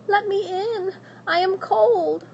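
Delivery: put-on high, pleading young princess. Princess begging at the witch's door, high and pleading.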